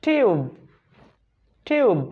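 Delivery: "টিউব" said with a long u; slow, clear "টিউব" with the u stretched; long vowel sound. Shown as a demonstration. Teacher drills "টিউব" as a long-sound example. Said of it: "এই গেল long সাউন্ডে".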